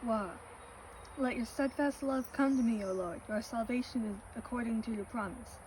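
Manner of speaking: ordinary talk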